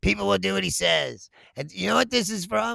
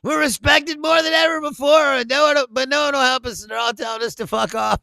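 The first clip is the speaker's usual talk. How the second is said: mock Trump boast; 7 semitones higher, 7 dB louder than usual. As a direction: puff up and boom it out like a pompous president. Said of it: "Trump keeps walking around".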